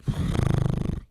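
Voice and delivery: loud breathy sigh; exasperated sigh. Retold or imitated. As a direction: blow out a frustrated breath. Exasperated sigh in retold reaction.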